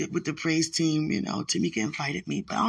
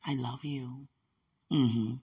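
usual speaking voice

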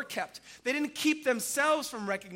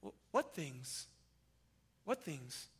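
a hint of a lower, much softer Jesus; Jesus asking gently; 9 semitones lower, 11 dB quieter than usual. Jesus gently prompting the two disciples, lightly voiced. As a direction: draw them out with a soft, knowing question. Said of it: "Jesus said to them".

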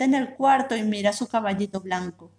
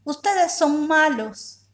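put-on child's slow shout; 6 semitones higher, much slower than usual. A narrator's reading. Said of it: "Yayita grita".

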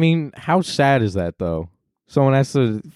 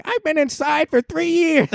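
mock Kamala. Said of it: "she's like".

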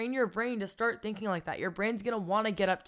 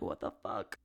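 muttered what the fuck; 12 semitones lower, 7 dB quieter, slower than usual. Retold or imitated. Imitating a hurt gift-giver.

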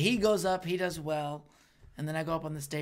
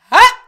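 a sharp, very high, loud single laugh; mock sarcastic laugh; 22 dB louder than usual. Imitating KJ's sarcastic laugh, loud. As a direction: bark one fake laugh. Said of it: "And he's like".

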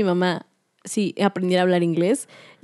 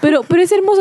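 high, louder gush of delight; excited; 10 semitones higher, 4 dB louder than usual. Real, not acted. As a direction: burst out with delight. Gushing about kind Canadians.

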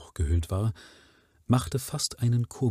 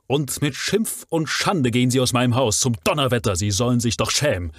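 loud, fast and higher tirade; furious rant; 7 semitones higher, 5 dB louder, faster than usual. A narrator's reading. Sir Henry dismisses the disloyal butler, furious tirade. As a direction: tear into the servant. Master throws servant out.

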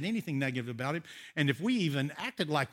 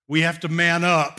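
firm dad voice; 3 semitones higher, 13 dB louder than usual. Retold or imitated. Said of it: "he sounds just like my daddy".

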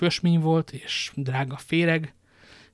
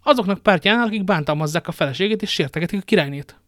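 louder, higher, indignant wife; outraged exclamation; 5 semitones higher, 7 dB louder than usual. A narrator's reading. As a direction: throw the accusation at him. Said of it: "kiáltott fel". (hangos, felháborodott kiáltás)

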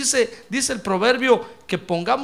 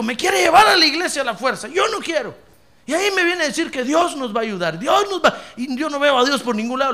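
complaining man; 5 semitones higher, 5 dB louder than usual. Retold or imitated. Y me dijo.

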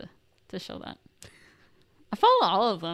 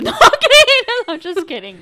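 laughing loudly through the words; giggling it off; 12 semitones higher, 14 dB louder than usual. Real, not acted. Laughing at her own joke.

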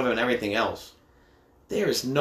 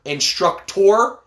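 Instructor stretched slowly, higher and louder; drawn-out instructor; 7 semitones higher, 6 dB louder, much slower than usual. Shown as a demonstration. Dad correcting his son, drawn-out loud Instructor. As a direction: draw out Instructor slowly and loudly. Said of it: "it's how you fucking say it".

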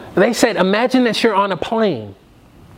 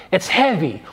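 normal talking voice

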